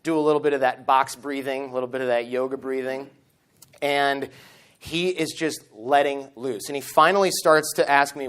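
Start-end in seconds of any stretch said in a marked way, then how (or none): none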